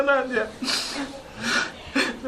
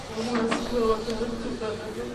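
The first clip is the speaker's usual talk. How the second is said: soft, low, fading farewell; hushed grief; 4 semitones lower, 10 dB quieter than usual. Real, not acted. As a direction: speak barely above a breath. Quiet farewell words, fading. (lirih berduka)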